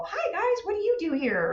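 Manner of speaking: imitating her own cheerful party greeting; friendly greeting. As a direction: breeze up to strangers all sunny. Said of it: "I'd stand and go".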